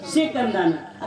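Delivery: ordinary talk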